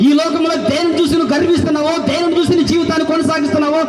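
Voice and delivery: fiery sermon climax; preaching at full force. Acted. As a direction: hammer the warning home. Warning against pride.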